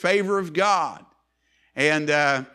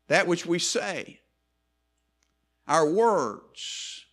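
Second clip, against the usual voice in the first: normal talking voice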